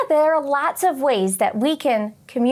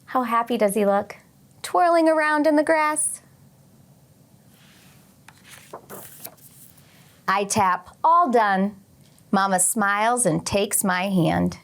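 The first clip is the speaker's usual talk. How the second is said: gentle storybook read-aloud voice; reading aloud to children. Acted. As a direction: read it like story time for the class. Teacher reads picture book aloud.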